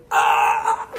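high strangled choking noise; imitated throttled squeak. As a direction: act out trying to yell while choked. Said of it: "I was being choked".

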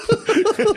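squealing laugh that shoots way up; high burst of laughter. Real, not acted. Laughing at the drunk woman's insult, high squeal.

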